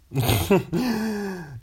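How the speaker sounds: high laugh tailing downward; amused laugh. Real, not acted. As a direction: laugh at your own imitation. Amused at own uptalk imitation.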